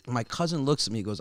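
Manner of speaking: normal talking voice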